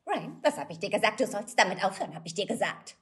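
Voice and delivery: bossy Tussi dubbing voice; Sharpay. Shown as a demonstration. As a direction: scold like a bitchy diva. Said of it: "Die Tussi".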